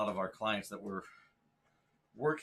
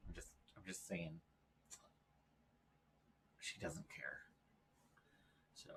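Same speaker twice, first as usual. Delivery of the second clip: hushed aside under the breath; whisper; 7 semitones lower, 19 dB quieter than usual. Real, not acted. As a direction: whisper it off mic. Aside after scolding the dog.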